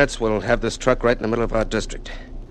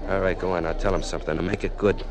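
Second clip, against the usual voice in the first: usual speaking voice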